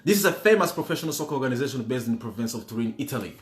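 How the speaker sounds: fast, hurried repeat of the clue; quick rerun of the question; rushed reread. Shown as a demonstration. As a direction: rattle it off quickly to save time. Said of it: "Let me save you time".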